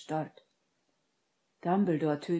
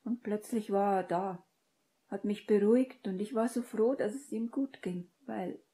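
higher-pitched voice, retelling a scare; higher character voice, trailing off at the end; upset young woman recounting; 6 semitones higher than usual. A narrator's reading. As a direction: voice Hermine relieved yet troubled.

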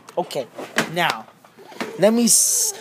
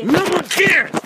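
mock angry snap; 9 semitones higher than usual. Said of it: "I'm so angry now".

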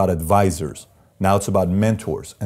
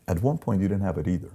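ordinary delivery

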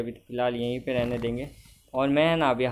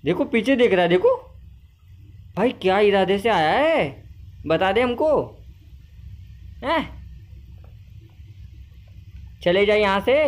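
loud, high-pitched challenge; raised voice, warning someone off; mock intimidation; 7 semitones higher, 3 dB louder than usual. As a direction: demand answers like a play-acting bully. Threatens a staring game character.

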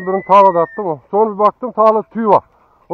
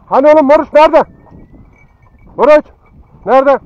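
loud, raised call; 5 semitones higher, 6 dB louder than usual. Real, not acted. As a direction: shout out to find the dog. Calling out for the dog. (köpeği yüksek sesle arama)

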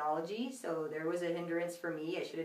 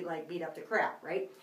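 normal talking voice

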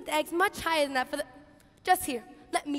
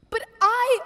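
indignant outburst; 6 semitones higher, 6 dB louder than usual. Acted. Martha protests to teacher.

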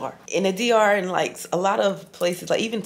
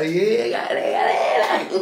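laughing; 2 semitones higher, 3 dB louder, much slower than usual. Real, not acted. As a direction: cackle it out with pride. Laughing, hyping herself up.

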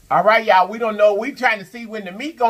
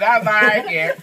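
goofy, playful sing-song; silly voice; much slower than usual. Real, not acted. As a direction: ham it up playfully. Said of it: "Stop being so silly".

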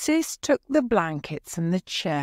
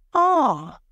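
short high startled cry; a sudden frightened little gasp; 9 semitones higher, 5 dB louder than usual. A narrator's reading. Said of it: "giving a little cry".

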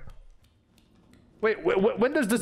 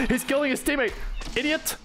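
high-pitched amusement just after a laugh; amused; 8 semitones higher than usual. Real, not acted. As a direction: crack up at a foe's blunder. Amused by enemy hitting teammate.

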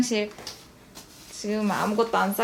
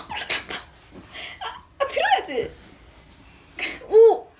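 excited; 11 semitones higher than usual. Real, not acted. Baby climbed up.

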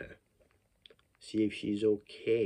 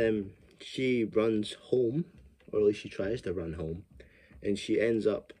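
normal talking voice

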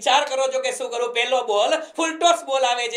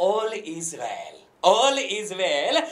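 soothing mantra; 4 semitones lower, much slower than usual. Acted. Calm reassuring motivational close.

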